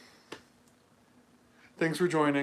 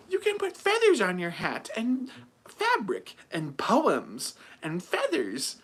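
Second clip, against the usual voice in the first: high, loud put-on seller voice; mocking sales pitch; 9 semitones higher, 5 dB louder, slower than usual. Hat stall seller pitching accessories, mocking high voice.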